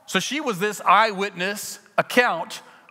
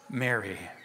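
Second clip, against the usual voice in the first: tender; 10 semitones lower, 7 dB quieter than usual. Acted. Jesus speaking her name.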